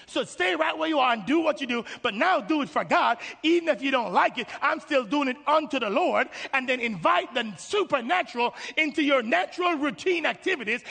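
high, loud, driving sermon delivery; full-force preaching. Real, not acted. Preacher urging congregation to serve God where they are, forceful exhortation.

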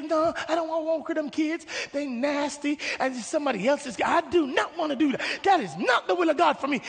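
high-pitched acted protest; whiny refusal. Believer refuses to work with kids, high acted complaint. Believer argues against the calling.

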